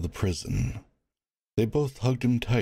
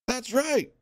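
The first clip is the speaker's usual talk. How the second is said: much higher voice, backing the crowd; agreeing heckler. Acted. Another prisoner agreeing, heckling. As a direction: chime in with support.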